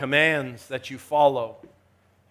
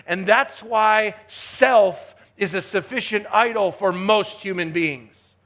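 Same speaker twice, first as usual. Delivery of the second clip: forceful sermon delivery, leaning on key words; emphatic preaching; 5 semitones higher, 7 dB louder than usual. Real, not acted. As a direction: preach it with rising and falling emphasis.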